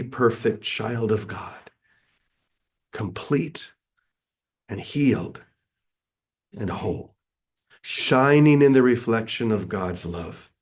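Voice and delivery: slow, solemn affirmation with long pauses; complete certainty. Acted. Speaker modelling words of holiness for the congregation, complete certainty. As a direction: declare it phrase by phrase, like a creed. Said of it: "say with complete certainty".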